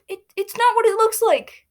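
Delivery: put-on high girlish pleading voice. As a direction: plead desperately. Aphmau pleads.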